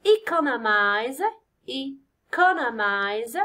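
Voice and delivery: economize very slowly, e-CO-nomize; stress on CO. Shown as a demonstration. Teacher showing the stressed syllable, drawn-out e-CO-nomize. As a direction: stretch economize out, leaning on the second syllable. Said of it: "تجي على سي".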